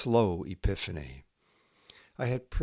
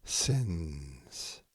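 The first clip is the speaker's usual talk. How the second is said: slow, measured haiku reading with long pauses between poems; poetry reading; slower than usual. Acted. Poet reading her own haiku to listeners, slow and measured. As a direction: let each image land before the next.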